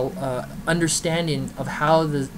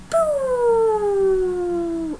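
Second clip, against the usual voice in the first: catapult boom. Retold or imitated. Catapult launch sound effect.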